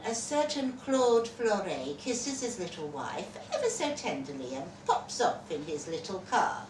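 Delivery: usual speaking voice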